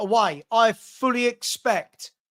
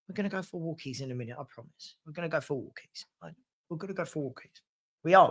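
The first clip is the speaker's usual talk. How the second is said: doggy talk; 5 semitones lower, 9 dB quieter, faster than usual. Real, not acted. Promising the dog a walk.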